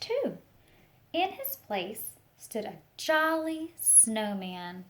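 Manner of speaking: put-on expressive picture-book reading for children; storytelling read-aloud. Teacher reading a picture book to the class, storytelling voice. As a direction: paint the story for a class of little ones.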